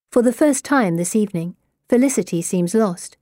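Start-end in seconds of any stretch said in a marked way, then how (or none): none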